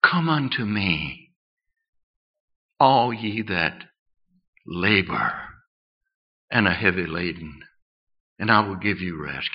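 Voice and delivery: hushed, unhurried appeal; tender invitation. Acted. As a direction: invite the weary gently, with long pauses. Gentle divine invitation quoted.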